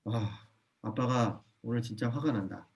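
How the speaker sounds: soft, slow, controlled telling of anger; calm stated anger. Shown as a demonstration. Father calmly telling his child he is angry. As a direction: say you're angry without raising your voice. Parent naming own anger.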